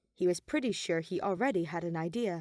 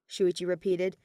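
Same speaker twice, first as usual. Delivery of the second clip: ordinary delivery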